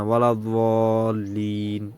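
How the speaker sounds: "দলিন" very drawn out, first sound neither z nor d; in-between pronunciation. Shown as a demonstration. Teacher showing it is like neither letter, slow in-between pronunciation. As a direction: stretch "ওয়ালা দলিন" slowly, the d blurred towards z. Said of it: "জ এর মতো না".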